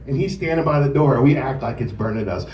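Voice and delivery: usual speaking voice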